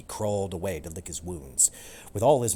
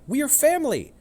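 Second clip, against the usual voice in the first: put-on high, pleading young cat; 8 dB louder than usual. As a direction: beg him not to fight. Romeo pleads.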